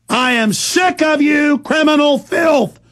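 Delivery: slow, high furious yelling at officials; furious shout. Real, not acted. Ranting host yelling at government spies. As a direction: bellow each accusation, slow and raging. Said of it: "it makes me mad".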